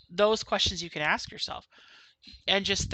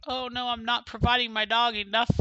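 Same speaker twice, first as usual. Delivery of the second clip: mock fretting owner; 6 semitones higher, 4 dB louder than usual. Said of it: "don't be all depressed like".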